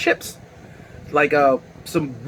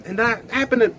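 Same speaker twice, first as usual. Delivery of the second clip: usual speaking voice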